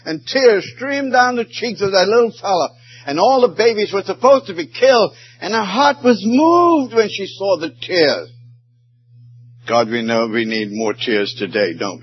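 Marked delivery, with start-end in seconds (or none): 0.0-8.3 high impassioned preaching; rising preacher's fervor. Acted. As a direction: lift it up, pleading with the crowd. Preacher's emotional story peak.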